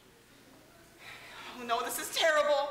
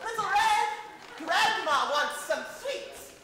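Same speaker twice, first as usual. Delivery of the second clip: grandma calling out; 5 semitones higher, slower than usual. Acted. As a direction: call her like a pushy grandma. Passive-aggressive grandma calls.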